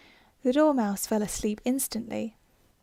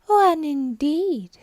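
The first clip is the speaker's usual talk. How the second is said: slow, high and louder, offended; indignant; 5 semitones higher, 7 dB louder, much slower than usual. Acted. Dormouse offended by Alice, indignant and slow. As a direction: scoff it out slowly. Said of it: "said the Dormouse indignantly".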